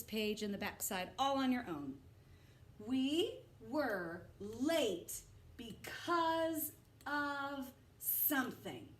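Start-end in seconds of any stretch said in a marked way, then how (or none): 2.8-8.9 slow, drawn-out sentence read; slow test read. Shown as a demonstration. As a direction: stretch it out for the class. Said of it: "Let's check our choices".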